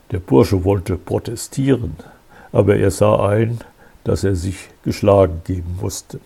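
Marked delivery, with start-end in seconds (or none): none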